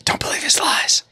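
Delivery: voiceless conspiratorial whisper; hushed, playful stage whisper; mock whisper. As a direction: whisper it to the audience as a joke. Mock plea to chat after teasing.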